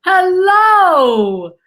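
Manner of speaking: a loud, high, showy hello as she comes on; big hello. Real, not acted. Guest bursting on camera to greet host, excited high hello. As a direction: greet him like a grand stage entrance.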